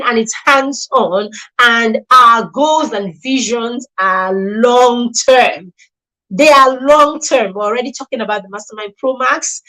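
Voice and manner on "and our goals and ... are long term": emphatic slow declaration; stress on long-term. Real, not acted. Stressing the vision of the programme.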